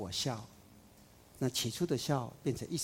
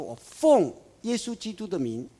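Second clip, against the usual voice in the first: loud, higher commanding call; exorcist's command; 6 semitones higher, 6 dB louder than usual. Retold or imitated. Sons of the synagogue ruler commanding the demon, loud. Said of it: "他说".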